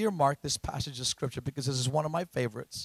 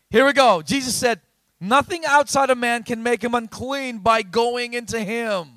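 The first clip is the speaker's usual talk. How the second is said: loud, raised-pitch scripture proclamation; full-force preaching; 6 semitones higher, 11 dB louder than usual. Acted. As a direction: belt out the verse to the congregation.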